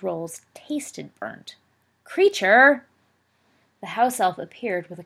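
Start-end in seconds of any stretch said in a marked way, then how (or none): 2.0-2.8 loud sharp call for the elf. Acted. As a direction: bark the elf's name across the room. Boy summons the house elf.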